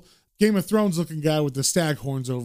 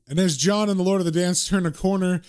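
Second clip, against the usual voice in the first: ordinary delivery, nothing marked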